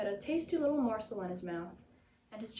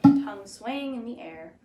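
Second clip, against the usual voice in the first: plain reading voice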